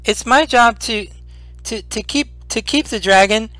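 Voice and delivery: high stammering little creature voice; a small creature announcing its duty; proud kobold. Acted. Kobold Meepo telling the players his job, high and stammering. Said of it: "he says to you".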